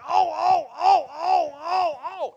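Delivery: high, loud repeated "oh"s; comic groans of a man straining at the gym; mock groaning. Imitates himself straining at the gym.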